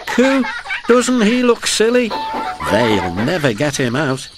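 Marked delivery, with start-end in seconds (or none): 0.1-2.1 put-on much higher giggling child voices; jeering kids. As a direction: laugh at him like cheeky little boys. Little boys jeering.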